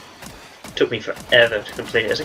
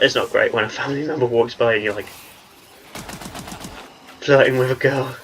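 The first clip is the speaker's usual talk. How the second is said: ordinary talk, nothing marked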